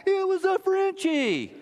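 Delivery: high, drawn-out imitated shout; slow, high-pitched mocking yell; mock group yell. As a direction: holler it like an indignant crowd. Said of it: "a group yelled back".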